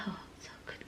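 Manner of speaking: breathy whisper of pleasure, barely audible. Retold or imitated. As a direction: whisper it like a blissed-out old lady. Mother-in-law enjoying head scratch. (pabulong na sarap na sarap)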